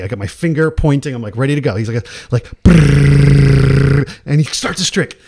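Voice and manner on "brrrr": loud, sustained rolled trill; big vocal drumroll; imitated drumroll. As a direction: roll the tongue like a snare. Imitates drumroll starting the trick.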